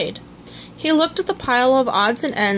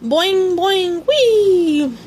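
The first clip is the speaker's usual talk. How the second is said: high bouncy boing-boing wee; high gleeful boings; gleeful exclaim; 7 semitones higher, 4 dB louder, much slower than usual. A narrator's reading. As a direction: bounce the sounds with glee. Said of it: "exclaimed Tigger".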